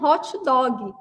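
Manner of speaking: "hot dog" said "hótchi dógui"; Brazilian pronunciation. Retold or imitated. Retelling her order to the American waiter, Brazilian pronunciation. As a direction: Brazilian "hot dog", an i after each word. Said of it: "eu pedi lá pro garçom americano".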